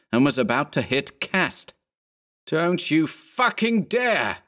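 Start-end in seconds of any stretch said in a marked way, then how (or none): 2.5-4.4 high, drawn-out desperate yell; slow, high-pitched furious warning. A narrator's reading. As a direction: yell it slowly to stop him, pitched high. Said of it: "Berman yelled".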